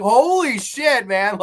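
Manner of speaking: drawn-out stunned outburst; shocked exclamation. Retold or imitated. Recalling the twist, stunned at the reveal. Reviewer's own shocked reaction.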